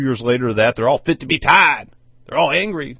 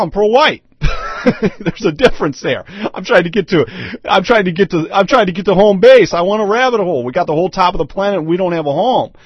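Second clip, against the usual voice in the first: ordinary talk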